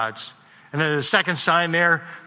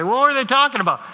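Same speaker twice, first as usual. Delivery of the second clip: quoting wife; 8 semitones higher, 4 dB louder, faster than usual. Retold or imitated. Wife Sheila asks, quoted.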